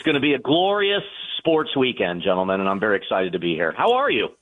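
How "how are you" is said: usual speaking voice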